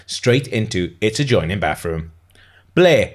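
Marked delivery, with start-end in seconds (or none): 2.7-3.1 higher, louder call; calling after someone. A narrator's reading. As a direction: call out after a friend who is leaving. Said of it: "Serena called after her".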